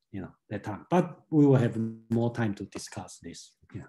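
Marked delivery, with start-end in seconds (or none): none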